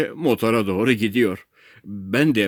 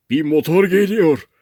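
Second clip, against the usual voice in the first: raised, louder call of news; mildly excited, pitched-up sighting of help; excited announcement; 8 semitones higher, 5 dB louder than usual. A narrator's reading. Friend spots a motorboat coming, excited. Ahbap spots a rescue boat.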